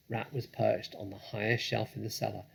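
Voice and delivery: ordinary delivery, nothing marked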